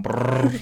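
rapper's rolled r brr. Retold or imitated. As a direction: roll the r like [name]. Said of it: "He's always like".

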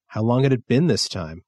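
plain reading voice

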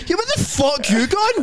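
high-pitched mock-furious heckle; mock outrage. Joining in as the fan shouting after a leaver, mock outrage.

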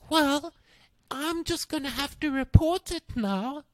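high, mocking teacher voice; mock prissy teacher. Narrator mimicking the strict teacher who threatens to report him. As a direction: play her as a smug, prim official.

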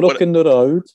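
put-on Brummie accent. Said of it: "You're blocking".

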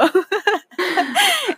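high nervous giggle; nervous laugh. Real, not acted. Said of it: "me río de nervios".

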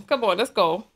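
ordinary talk, nothing marked

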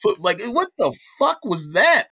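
retold bewilderment, climbing in pitch; stunned disbelief, retold. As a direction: replay a dazed, rising incredulity. Voices his panicked inner reaction.

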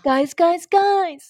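high, loud grand proclamation; dramatic fantasy voice. Pretend. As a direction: call out like an epic hero. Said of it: "if you keep talking like that".

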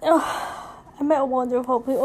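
ordinary delivery

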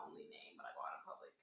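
whisper. Retold or imitated. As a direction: drop to a secret whisper. Quoting herself, sudden whisper.

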